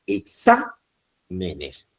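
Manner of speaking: slow "exámenes", SA stressed and held. Shown as a demonstration. Teacher showing students an esdrújula plural, stress on SA in "exámenes". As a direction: lean on the SA in "exámenes", then break. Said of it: "sería la antepenúltima sílaba".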